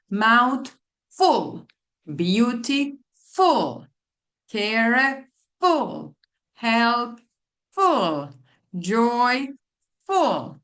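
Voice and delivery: teacher stressing the suffix so the class hears it; stressed suffix. Shown as a demonstration. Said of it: "can hear when I'm saying these words that this full comes".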